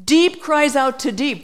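ordinary talk, nothing marked

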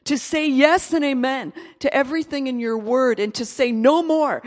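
loud, rising preacher's prayer, slowing to the end; fervent closing prayer. Acted. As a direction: pour it out to God with full force. Leads closing prayer at full force.

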